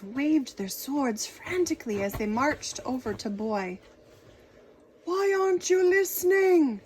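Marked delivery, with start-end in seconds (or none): 5.0-6.8 loud, high exasperated shout. Acted. King shouting at Boy who ignores him, loud exasperated. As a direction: yell in frustration. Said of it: "shouted the king".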